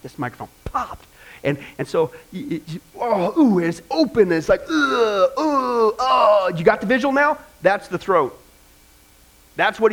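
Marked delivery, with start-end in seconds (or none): 3.4-6.5 disgusted ooh. Acted. Acting disgust at carcass.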